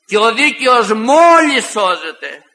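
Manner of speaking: solemn sermon warning; solemn preaching. Acted. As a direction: warn gravely.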